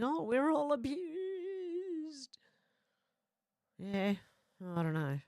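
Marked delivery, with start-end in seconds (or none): 0.0-2.4 slow, high whiny mock voice; mock victim whimper, high and stretched; mocking whine. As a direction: sneer at them with a drawn-out sob-story voice. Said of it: "Sick of people going".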